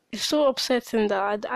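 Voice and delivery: usual speaking voice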